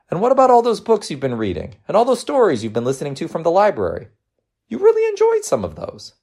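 high, eager mom voice; upbeat motherly suggestion; encouraging mother. Acted. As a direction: prompt the child with enthusiasm.

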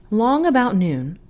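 ordinary delivery, nothing marked

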